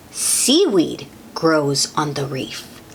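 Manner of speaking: ordinary delivery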